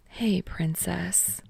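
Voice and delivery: put-on slow, hushed greeting to a child; tender whisper. A narrator's reading. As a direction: whisper it gently and slowly. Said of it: "he let out a whisper to her".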